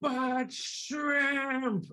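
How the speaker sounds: put-on high, childish whine; whiny plea. Diner whining at staff that they still want shrimp. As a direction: pout it like a kid who won't let go.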